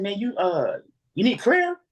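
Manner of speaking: high, rising offer of prayer; lightly voiced eager question. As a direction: ask it up high, almost pleading. Asking the nervous man, rising.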